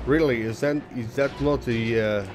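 hammed-up fake Russian accent, slowed; bad Russian accent. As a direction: play a mock Russian comrade dragging a friend down. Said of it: "This is shit accent".

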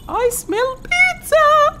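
very high, very slow, loud singsong; sing-song falsetto. Pretend. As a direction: croon it like a silly tune. Sing-song game remark. (غناء مازح بصوت رفيع جداً)

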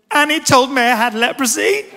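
loud, high, upset daughter; mock tearful protest to a father; imitated wailing child. Acting out the crying daughter.

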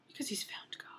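unvoiced whispered aside. Real, not acted. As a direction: breathe it like a secret.

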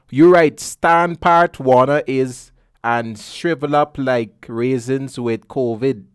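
slow, loud word-by-word read; laborious reading. Real, not acted. Host retrying a tweet typed in Bajan, slow and deliberate. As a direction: push through unfamiliar spelling slowly and loudly. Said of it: "this person typing Bajan on the tweet".

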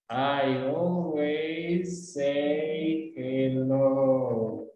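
words joined in one slow, unbroken flow; very slow, fluid. Shown as a demonstration. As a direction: stretch every word out, all linked together. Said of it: "te puedo decir súper lento".

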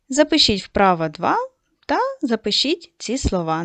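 ordinary talk, nothing marked

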